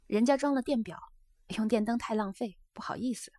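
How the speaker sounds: lightly voiced geisha, a little livelier; a hint of an earnest young geisha; geisha confiding. Geisha tells her guest of changes at home, confiding.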